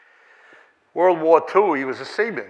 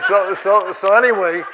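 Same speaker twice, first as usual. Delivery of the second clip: loud, lifted, still chuckling; real leftover laughter in the words; laughing; 6 semitones higher, 9 dB louder than usual. Real, not acted. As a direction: try to move on through laughter. Recovering from laughter.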